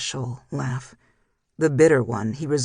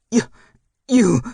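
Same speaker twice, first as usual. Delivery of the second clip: sputtering, much louder furious outburst; choking with fury; 7 semitones higher, 9 dB louder than usual. A narrator's reading. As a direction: choke on the words in rage. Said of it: "so furious that he was choking on his words".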